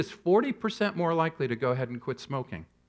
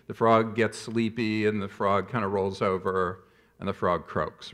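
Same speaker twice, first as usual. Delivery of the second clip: slowing, fading sleepy; 6 semitones lower than usual. Acted. Frog slowly dying in warm water.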